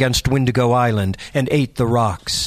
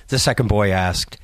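ordinary delivery

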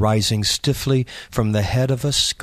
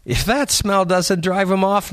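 higher youthful voice; joking teen boy; 7 semitones higher than usual. A narrator's reading. Third boy mocking the smell, high boy voice. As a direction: crack the joke like a teenage boy.

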